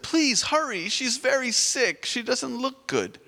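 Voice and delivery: high, urgent plea from a worried mother; pleading mother. Acted. Mother begging for help.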